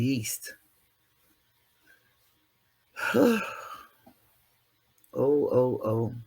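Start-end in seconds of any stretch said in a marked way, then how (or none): 3.0-3.7 sharp high weary sigh. Real, not acted. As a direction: let out a high tired sigh. Weary about sounding like a witch.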